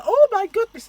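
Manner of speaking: high startled shriek; shocked shriek. Retold or imitated. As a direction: squeal it out in shock. Passer-by shocked after scratch.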